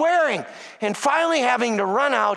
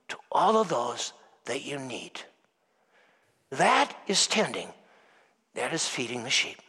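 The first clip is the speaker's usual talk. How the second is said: soft, low closing; 9 semitones lower, 4 dB quieter than usual. Acted. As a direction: let the words settle gently on the people. Quiet closing exhortation.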